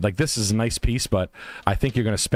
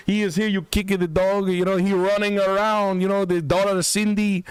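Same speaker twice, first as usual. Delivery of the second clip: higher, hammed-up Puerto Rican accented English; mock Puerto Rican realtor; 6 semitones higher than usual. Pitching a Puerto Rico house to listeners, hammed-up Puerto Rican accent. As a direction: sell the house like a brash Puerto Rican agent.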